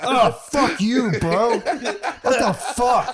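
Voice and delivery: high, slow, drawn-out mock outrage; mad wife. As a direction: groan it out like a wife jolted from sleep. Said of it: "out of my wife was like".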